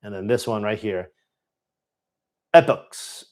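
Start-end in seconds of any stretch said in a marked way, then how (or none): 2.5-3.3 "Epochs" said as EP-ooks, short first E; unsure pronunciation. Shown as a demonstration. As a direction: try "Epochs" with a short e, stressed up front. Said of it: "no idea how to pronounce it".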